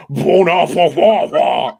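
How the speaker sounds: slow, loud hammed-up Cajun French "Bon appetit"; mock Cajun French. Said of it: "Cajun French".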